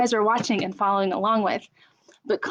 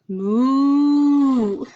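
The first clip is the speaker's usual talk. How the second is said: mooing cow; 4 semitones higher, 5 dB louder than usual. Shown as a demonstration. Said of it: "right option for kids".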